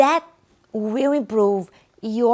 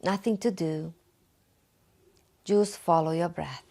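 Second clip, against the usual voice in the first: guided meditation; 4 semitones lower, 5 dB quieter than usual. Acted. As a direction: guide the listener gently through breathing, unhurried and calm. Leads a guided breathing meditation.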